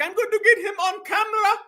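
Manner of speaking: high-pitched imitation of the troll; mocking her voice. Voices the woman trolling the man.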